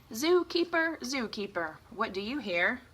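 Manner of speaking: sing-song call to the leopard; reading to children. Acted. Teacher reading aloud to kids, sing-song. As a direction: chant it to the little listeners.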